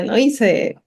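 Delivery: usual speaking voice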